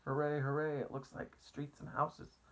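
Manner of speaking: high cheering, then breathy; excited cheer. A narrator's reading. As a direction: cheer at the first sight of land. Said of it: "they cried".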